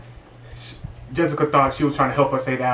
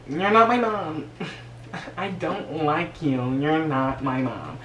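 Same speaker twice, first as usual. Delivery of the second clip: put-on bratty teen; 3 semitones higher, slower than usual. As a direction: pout it at the stepmom. Bratty stepdaughter quoted.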